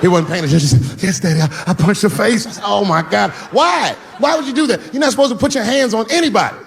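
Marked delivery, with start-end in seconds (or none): 1.0-2.5 put-on higher, small boyish voice; a kid owning up, meek; little son voice. Son admitting the punch to dad, small high voice. 2.7-6.6 high, alarmed disbelief; a father horrified by the answer; shocked dad. Retold or imitated. Dad reacting to son's punch, high and shocked.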